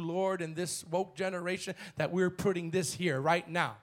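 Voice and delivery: ordinary delivery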